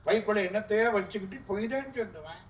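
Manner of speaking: ordinary talk, nothing marked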